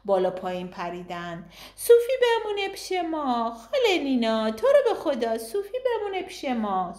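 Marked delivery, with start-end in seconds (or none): 1.8-6.9 put-on high, pleading child voices; begging children. A narrator's reading. Twin girls begging their aunt to let Sufi stay, high and pleading. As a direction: plead like excited little kids.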